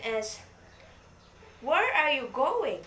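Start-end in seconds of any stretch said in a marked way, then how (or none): 1.6-2.8 "Where are you going?" with lifted, swooping question pitch; rising question intonation. Shown as a demonstration. Teacher giving class an example question, rising intonation on "Where are you going?". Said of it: "that is a rising intonation".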